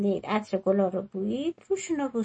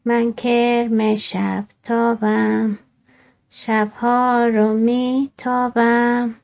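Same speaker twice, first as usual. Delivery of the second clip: very slow, measured, louder recital; poem recitation; 5 dB louder, much slower than usual. Acted. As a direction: recite the poem slowly, lingering on each line.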